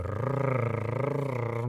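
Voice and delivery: soft imitated cat purr, rolled rrr. Shown as a demonstration. Teacher imitating her purring cat, softly. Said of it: "hacen este sonido".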